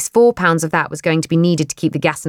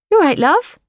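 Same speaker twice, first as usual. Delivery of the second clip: high lilting "You all right, love?"; a motherly call across the staff room; kindly older woman; 12 semitones higher, 3 dB louder than usual. Acted. Older coworker greets tired Kate.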